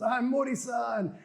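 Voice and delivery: high, drawn-out on-air self-introduction; imitated TV co-host. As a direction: mimic a co-host announcing herself.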